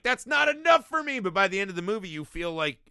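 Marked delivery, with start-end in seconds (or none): 0.0-1.2 much higher, loud mock shout; shouted protest. Viewer yelling at God's answers, mock shout. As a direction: hurl it back as a frustrated viewer.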